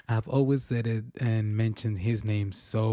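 ordinary talk, nothing marked

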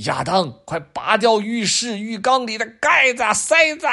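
high, loud frantic shout; urgent yell. Acted. Dad yelling at Adam in the bath, loud urgent shout. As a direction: yell through the door to stop the flood.

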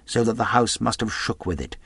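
ordinary delivery, nothing marked

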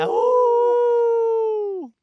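very high, loud, long howl like a wolf. Pretend. Worker capping his wolfman rant with a loud wolf howl. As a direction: throw your head back and howl.

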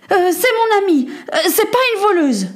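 put-on high-pitched hesitant boy; high boyish protest; insistent defence. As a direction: stammer out a plea for a friend. Boy defends his friend.

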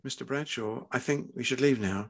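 quiet, firm instruction; firmly. A narrator's reading. Doctor asking the father to leave, quietly firm. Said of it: "she said firmly".

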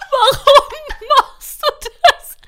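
high, loud question squeezed out through laughter; laughing fit. Retold or imitated. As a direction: ask it while helplessly laughing. Retelling her own laughing fit.

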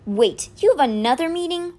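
high, put-out question; incredulous and a little annoyed; contained disgust. A narrator's reading. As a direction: ask it with disgust held back. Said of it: "trying to contain her disgust".

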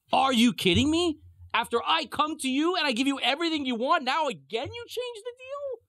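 high-pitched, acted indignant protest; outraged disbelief. Pharaoh protesting the changed deal, outraged.

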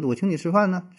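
Jinzhou statement with the end tilting up; rising tail in Jinzhou dialect; Jinzhou accent. Retold or imitated. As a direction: Jinzhou tune, not a question. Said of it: "那尾音儿他往上翘".

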